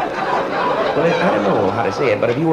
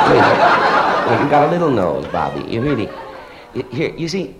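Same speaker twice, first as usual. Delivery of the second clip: ordinary delivery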